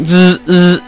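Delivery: buzzing phone noise; imitated phone buzz. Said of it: "my phone's like".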